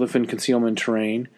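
ordinary talk, nothing marked

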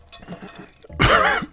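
hacking smoker's cough; harsh coughing fit. Real, not acted. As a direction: cough hard after a big hit. Coughing after hitting pipe.

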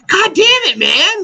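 high-pitched mock frustration; hammed-up indignant yelp; exasperated outburst. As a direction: replay your stung reaction as a squealed protest. Retells frustrated reaction to criticism.

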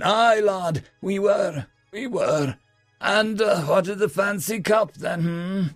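tipsy, high dwarf voice; slurry, eager old dwarf; drunken dwarf. A narrator's reading.

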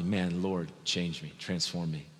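soft, low plea to God; pleading prayer. Acted. Praying to the Lord to be changed, hushed plea. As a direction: pray it softly, the pitch dropping.

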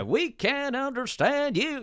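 high, slow, drawn-out Southern drawl; exaggerated Southern-accent echo; mock Southern accent. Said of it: "try not to do a Southern accent".

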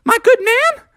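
squeaky high, fast salesman patter; very high-pitched cartoon voice, rattled off quickly; Mickey. Mickey pressing Father Frog to pay, high squeaky voice. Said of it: "And Mickey said".

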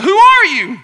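loud shout into the distance; acted-out loud call. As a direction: holler the question across the mountain. Boy calling out to unknown voice.

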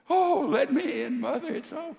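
high begging wail; tearful pleading. Acted. Daughter begging her mother to let her in, high and tearful. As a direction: plead high through tears. Crying daughter begs at door.

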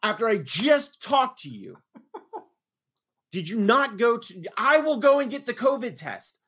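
high, loud outraged scolding; livid. Retold or imitated. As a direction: relive the fury, hammering key words. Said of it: "because I was livid".

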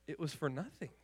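soft, dropped delivery of the point; preacher softly drives home the lesson; quiet emphasis. Real, not acted. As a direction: let it fall quietly.

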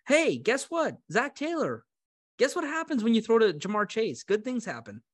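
high-pitched sarcastic advice; pointed mock lecture. Fan lecturing the Bengals coach, mock pointed advice. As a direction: pitch it up, spelling out the obvious.

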